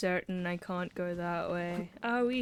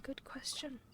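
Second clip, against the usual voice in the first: softly whispered aside; 5 semitones higher, 11 dB quieter than usual. Real, not acted. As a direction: breathe it without voice. Repeats the line in a whisper.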